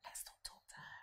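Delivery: hushed whisper behind her back; a schoolgirl whispering to her friend to snub the newcomer, whispered. Retold or imitated. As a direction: whisper it so she can't hear. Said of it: "must have whispered to the other one".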